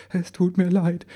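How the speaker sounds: high, tearful small voice; stammered apology. A narrator's reading. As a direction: apologise like a crushed little elf. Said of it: "stammelte der Kleine". (kleinlaut und hoch gestammelt)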